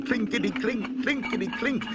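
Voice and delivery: high, staccato rattling of coins; coins clinking. A narrator's reading. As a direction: imitate coins jingling in a tin. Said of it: "and the coins going".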